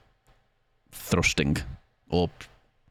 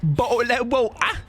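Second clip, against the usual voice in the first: higher posh British "Bottle of water"; hammed-up British "Bottle of water"; mock British accent; 14 semitones higher than usual. Shown as a demonstration. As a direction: say "Bottle of water" with dropped t's, high and plummy. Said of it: "Any words you'd like to say?".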